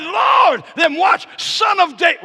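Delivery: shouted plea, high and loud; crying out. Acted. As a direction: cry out to him with everything. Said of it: "He said".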